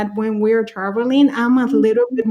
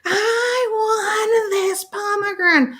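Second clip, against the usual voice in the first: high, slow mock wail of a small child; imitating a crying toddler; 11 semitones higher, slower than usual. Toddler melting down in the store, hammed-up crying wail. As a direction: whine it like a three-year-old in tears. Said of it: "He goes ballistic".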